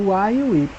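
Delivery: usual speaking voice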